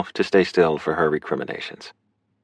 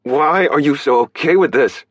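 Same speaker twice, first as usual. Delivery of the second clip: raised, higher-pitched outburst; loud, exasperated demand; exploding; 8 semitones higher, 5 dB louder than usual. A narrator's reading. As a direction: burst out at her in frustration. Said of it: "he exploded, voice raised".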